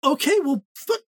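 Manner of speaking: mock scolding. Mock scolding a quiz player.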